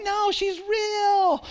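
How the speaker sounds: high, slow, drawn-out protest; insisting protest. Retold or imitated. Pastor retelling friends mocking his girlfriend, slow high insistence. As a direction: plead that she really exists. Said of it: "I'm like".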